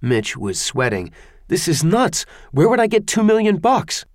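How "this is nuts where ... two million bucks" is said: panicked disbelief. Acted. Disbelief at ransom.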